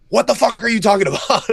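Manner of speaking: high, loud, incredulous scold; wife scolding. Retold or imitated. Wife grabs his face and scolds his fear, loud and high. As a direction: snap it at him in disbelief.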